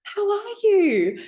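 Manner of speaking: put-on a fond old nan greeting her grandchild; nan's voice. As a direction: play the grandmother, overjoyed. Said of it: "And she goes".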